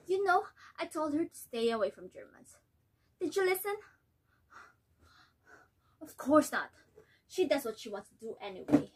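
bitter, complaining mother; resentful, defensive wife. Acted. Nella complains to husband.

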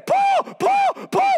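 high shrieked call; yelling his name. Acted. Demon-possessed girl pestering Paul day after day, high yelling. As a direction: yell it out like a pestering crazy lady. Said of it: "this crazy lady's behind him going".

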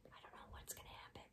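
hushed whisper aside; secretive storyteller whisper. Real, not acted.

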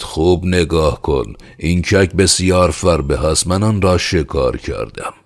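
loud, high insistence; heated, boastful demand; angry shout. Acted. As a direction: thrust the point at him forcefully. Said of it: "خشمگین شد و فریاد زد".

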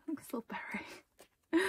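whispered through a laugh; a laughing, whispered aside; giggly whisper. Real, not acted. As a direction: giggle it under your breath. Giggling over photo.

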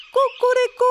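loud high rooster crow; angry crow. A narrator's reading. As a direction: crow it out furiously. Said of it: "kogut na patyku zapiał gniewnie".

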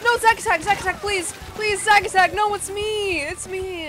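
high, loud begging, fading at the end; desperate pleading. Real, not acted. Player begging Zack Attack to know her, desperate. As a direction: beg frantically to be recognised.

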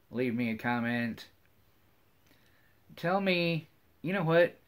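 ordinary delivery

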